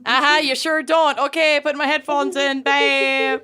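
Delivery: high, dismissive fake-polite brush-off; sing-song "sure you don't, babe"; mock stranger on the plane. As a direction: play the seatmate humoring her to end the chat. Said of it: "she just wanted you to leave her alone".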